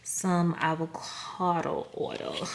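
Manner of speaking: "Some avocado oil" stretched out slowly, each word drawn long; odd drawn-out delivery. Real, not acted. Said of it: "I don't know why I said it like that".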